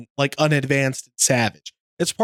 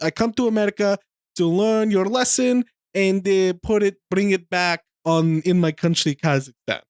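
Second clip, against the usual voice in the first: halting mock Kazakhstani accent, starting higher; earnest visitor with a put-on Kazakhstani accent; Borat's Kazakhstani accent; 4 semitones higher than usual. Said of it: "He says how".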